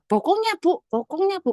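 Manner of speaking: high-pitched mock alarm; high, urgent retold warning; retold bystander's alarm. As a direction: imitate a concerned onlooker, pitched well up.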